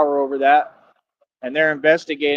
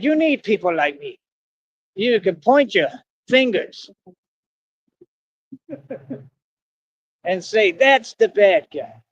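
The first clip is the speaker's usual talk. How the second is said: slow, higher-pitched theatrical villain; movie bad-guy voice; 5 semitones higher, slower than usual. Vlogger playing the bad guy for viewers, slow theatrical villain voice. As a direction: draw it out like a defiant film villain.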